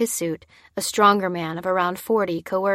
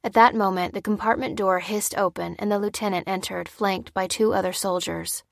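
plain reading voice

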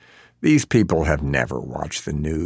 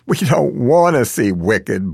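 slow blurt; 5 semitones higher, 4 dB louder than usual. A narrator's reading. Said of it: "blurts out the old lady".

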